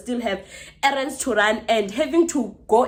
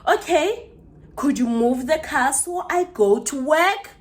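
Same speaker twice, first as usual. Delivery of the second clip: high, drawn-out mock-polite request; condescending; 5 semitones higher, slower than usual. Neighbour asking for the car to be moved, condescending. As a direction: imitate someone talking down, slowly and pointedly. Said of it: "You're condescending to me".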